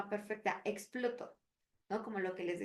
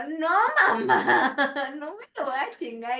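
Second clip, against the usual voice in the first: laughing through words; 3 semitones higher, 13 dB louder than usual. Real, not acted. Mother retelling her answer to grandma, laughing loudly. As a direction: laugh as you say it. Laughing while quoting herself.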